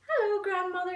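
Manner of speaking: put-on calling little girl. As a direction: call out brightly like a small girl at the door. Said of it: "she called".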